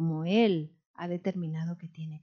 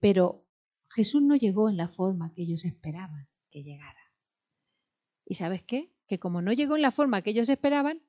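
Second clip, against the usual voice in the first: ordinary delivery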